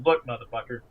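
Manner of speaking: ordinary talk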